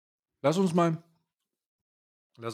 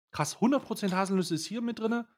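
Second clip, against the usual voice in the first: amazed; 8 semitones higher than usual. Real, not acted. Amazed at label.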